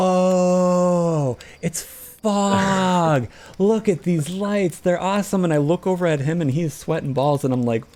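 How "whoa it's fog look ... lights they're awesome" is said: higher, slow, wide-eyed awe; awed wonder. Retold or imitated. Floating into the fog, retelling his awe, wide-eyed wonder. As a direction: gaze at the lights in wonder.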